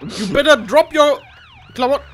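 normal talking voice

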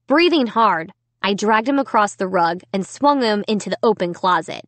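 usual speaking voice

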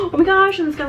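high gasping delight; gasp, thrilled by the find; excited gasp. Real, not acted. Gasps at new find.

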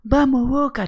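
high-pitched mock cheer, not full volume; imitated fan yell. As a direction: cheer like a crazed football fan. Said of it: "gritaba el loco".

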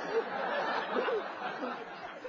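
breathy unvoiced laugh; laughter. Real, not acted. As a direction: laugh at yourself. Laughs at own story.

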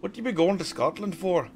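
put-on terrible Scottish accent. Player asking about a trip to Scotland, terrible Scottish accent. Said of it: "That's a terrible accent".

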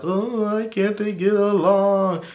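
whining as if the friends won't stop fighting; mock upset whine. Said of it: "Mariah was all mad. She's like".

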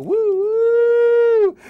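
very high, loud train whistle; train whistle woo. Acted. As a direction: hoot like a toy train. Choo-choo train game.

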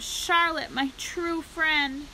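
high moan, slowing into the last words; anguished moan. A narrator's reading. Pig grieving his dying spider friend, moaning. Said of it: "he moaned".

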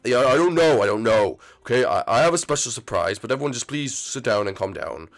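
ordinary talk, nothing marked

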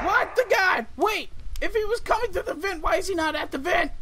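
high, loud panicked yelling; startled gamer yelling at the screen; panicked shout. Real, not acted.

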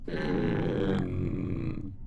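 a hint of the halting inhuman voice; flat, rhythmless single word; lightly mimicked eerie voice; lightly voiced creature. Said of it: "it just keeps saying".

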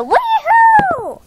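very high, held-out woohoo; triumphant whoop. Pretend. Toy cheers that the magic's here, triumphant whoop. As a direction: celebrate with a high playful whoop.

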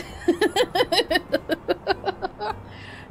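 a slow, contented cat chuckle; lazy chuckle. Acted. Cat mayor amused by his own laziness, chuckling.

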